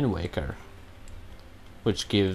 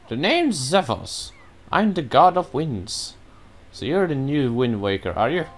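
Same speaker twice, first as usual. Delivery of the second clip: lilting voice for a wind god character; breezy wind god; 2 semitones higher than usual. Acted. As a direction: play a friendly god of winds greeting a beginner. Voices game character Zephyros reading dialogue.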